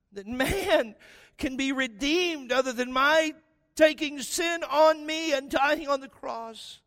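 high, loud desperate pleading prayer; Jesus crying out to his father in Gethsemane; anguished Jesus. Acted. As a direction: beg the father, voice straining.

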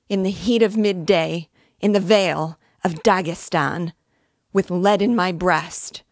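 loud, anguished recitation of a song; shrieking. A narrator's reading. Dying woman trying to sing her old song, shrieking. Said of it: "shrieking and gasping at every word".

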